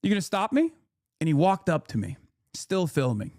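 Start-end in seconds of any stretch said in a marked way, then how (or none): 0.0-0.7 defiant dare. Retold or imitated. Squaring up to the influencer at the gate, high and goading. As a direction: goad him to try something. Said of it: "I just said".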